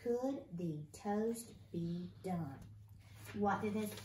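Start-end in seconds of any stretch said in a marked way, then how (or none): 0.0-2.6 slow, word-by-word reading aloud; drawn-out beginner-reader pace; slow careful reading. Shown as a demonstration. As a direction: read it one word at a time, slowly. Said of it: "So let's read it".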